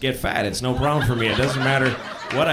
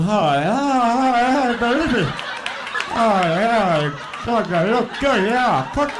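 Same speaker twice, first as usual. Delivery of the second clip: mock moaning lust; 5 semitones higher, slower than usual. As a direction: moan at the cooking show like it's porn. Drooling at the Food Network.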